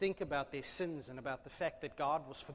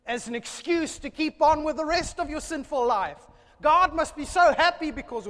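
from firm to a high, loud shout; building preacher's shout; 11 semitones higher, 15 dB louder than usual. Real, not acted. Preacher scolding empty fasting, rising to a shout. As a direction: let it swell into a roar.

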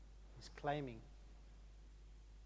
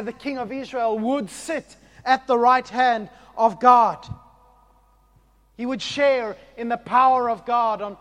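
louder, higher sermon voice; fervent preaching; 7 semitones higher, 19 dB louder than usual. Acted. Pastor explaining Psalm to the church, preaching fervently. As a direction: drive the point home.

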